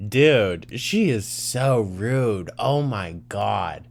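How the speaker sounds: slow, drawn-out indignation; loud opening, then slow scandalized emphasis; appalled disbelief. Real, not acted. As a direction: stretch the words out, genuinely appalled. Reacting to Amber's rudeness.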